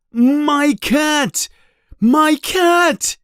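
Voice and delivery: very high, distraught cry; desperate yell. A narrator's reading. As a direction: wail it out in desperation. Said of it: "he yells with desperation".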